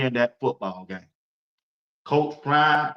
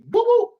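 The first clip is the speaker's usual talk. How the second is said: whoop sound effect; 5 dB louder than usual. Retold or imitated. Describing a juke move.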